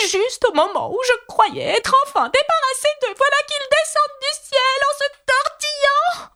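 piercingly high, frantic pigeon; high-pitched shriek. A narrator's reading. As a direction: screech the complaint at the top of your range. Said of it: "monta jusqu'à devenir un cri aigu".

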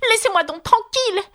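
high, indignant demand; offended little-girl protest. A narrator's reading. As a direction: insist on being left alone, pitched high. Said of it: "d'un ton indigné".

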